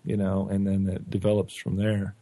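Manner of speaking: ordinary talk, nothing marked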